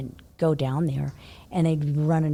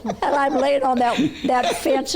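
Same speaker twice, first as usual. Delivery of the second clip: teary laugh, higher and louder; laughing through tears; 8 semitones higher, 5 dB louder than usual. Real, not acted. Telling friends about crying at the fence, laughing through tears. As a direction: laugh it off while tearing up. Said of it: "You're crying right now".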